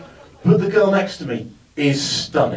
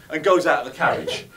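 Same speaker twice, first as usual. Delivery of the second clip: ordinary delivery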